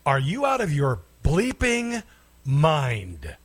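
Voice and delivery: drawn-out, incredulous rebuke with a falling last word; exasperated disbelief. Real, not acted. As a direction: pitch up, then drop hard on the final word. Outraged reply to played clip.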